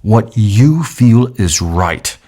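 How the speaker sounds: very slow, each word separate; very slow repeat. Shown as a demonstration. Trainer repeating the key line very slowly. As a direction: spell it out slowly. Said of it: "Listen to what I just said".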